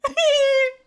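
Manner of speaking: a sudden high squeal of laughter. Real, not acted. Laughing at her old clip, a high squeal. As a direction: burst out in a shrill, delighted laugh.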